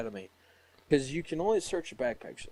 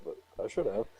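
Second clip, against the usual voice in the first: normal talking voice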